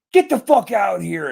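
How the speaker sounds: retold urgent command; imitated cop's shout. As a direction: act out a cop shouting orders. Retold cop ordering people out.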